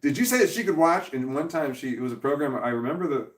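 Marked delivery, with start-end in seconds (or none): 0.0-1.1 higher, louder accusing question; imitating angry ex. Ex-wife calling the dad about Netflix, mock accusing. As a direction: mimic her shrill outrage, half-mocking. So her mom would call me.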